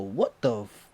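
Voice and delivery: loud, aggressive confrontation; street fury. Retold or imitated. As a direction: cousin squaring up to the attacker.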